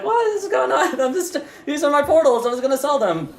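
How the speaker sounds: high, laughing protest; indignant squeak. Acted. Demon vendor protesting to police, squeaky and high. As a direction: whine about your confiscated goods.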